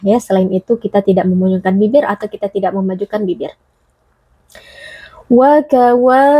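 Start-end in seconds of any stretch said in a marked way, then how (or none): 5.3-6.3 "Wa kawa" chanted with the last a held long; lengthened vowel. Shown as a demonstration. Teacher demonstrates mad, "Wa kawa" with long ending. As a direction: stretch the final "wa" of "Wa kawa". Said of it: "wa di sini terdapat mad tabi'i".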